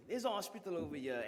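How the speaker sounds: usual speaking voice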